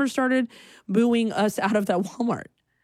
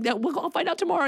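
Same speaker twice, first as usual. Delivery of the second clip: quick, pitched-up answer; high, fast reply; 10 semitones higher, faster than usual. Retold or imitated. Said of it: "And I'm like".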